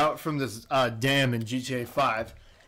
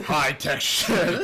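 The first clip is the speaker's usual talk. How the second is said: drawn-out hype shout of the phrase; hyped shout; much slower than usual. Real, not acted. Friend hyping gas station food, slow drawn-out shout. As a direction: shout it slowly like a hype man.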